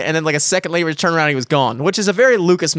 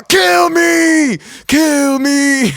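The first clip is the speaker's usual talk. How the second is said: high, loud drawn-out shout; full-throated mock plea; imitated desperate scream; 12 semitones higher, 5 dB louder, much slower than usual. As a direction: scream it like a crazed bystander. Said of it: "There's a fucking guy in the car".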